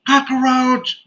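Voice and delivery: high-pitched mock-panicked announcement; retold alarm.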